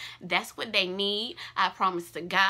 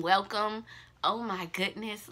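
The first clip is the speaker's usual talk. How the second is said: ordinary talk, nothing marked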